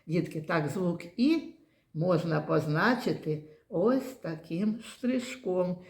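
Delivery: ordinary talk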